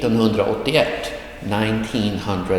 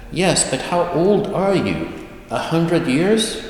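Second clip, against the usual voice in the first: put-on higher-pitched child's questioning; insistent grandchild; 8 semitones higher than usual. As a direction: press grandfather with a high, rising guess. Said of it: "And then the child says".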